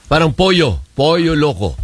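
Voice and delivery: "pollo" as PO-yo, the ll as y; Spanish double L. Shown as a demonstration. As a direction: demonstrate "pollo" with a y sound for ll. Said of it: "yeah yun".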